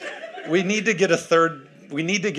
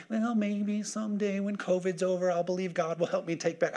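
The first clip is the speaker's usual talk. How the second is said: soft, higher-pitched wishful believer; mock timidity; 5 semitones higher, 5 dB quieter than usual. Preacher mimics believers waiting out covid, mock meekness. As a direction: imitate someone meekly putting it off.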